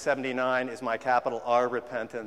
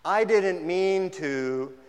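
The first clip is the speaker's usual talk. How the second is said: slow, louder drawn-out excuse; whiny excuse; 4 semitones higher, 5 dB louder, much slower than usual. Retold or imitated. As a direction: whine it like a defensive kid. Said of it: "you know what".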